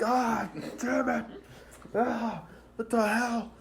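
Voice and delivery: high-pitched mock cry of pain; indignant acted yelp; pained outcry. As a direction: yelp as if poked in the eyes. Kenpachi just poked in eyes.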